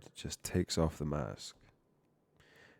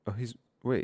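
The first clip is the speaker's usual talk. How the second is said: ordinary delivery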